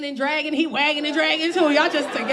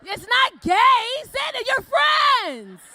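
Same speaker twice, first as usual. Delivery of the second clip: high, loud, slow drawn-out yell; slow hammed-up shout; 9 semitones higher, 4 dB louder, much slower than usual. Comic urging men to share pictures with friends, slow loud shout. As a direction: preach it at full volume. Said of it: "urging the men".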